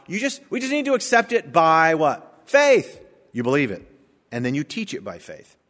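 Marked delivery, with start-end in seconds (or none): 2.4-3.0 shouted answer. Real, not acted. Preacher shouting the answer to his congregation, full force.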